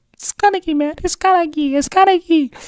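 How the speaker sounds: very high squeaky imitation; hammed-up high-pitched excitement; excited mock insistence. Vlogger imitates a manga character insisting on who someone is, excited mock voice.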